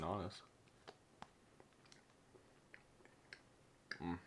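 talking while eating; chewing. Real, not acted. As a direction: mouth full of chocolate. Seen 0: Bites the bar at -4; lips closed and chewing through the words.

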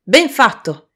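loud, high cry of praise; hearty shout. A narrator's reading. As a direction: shout your approval over the dance. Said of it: "gridò".

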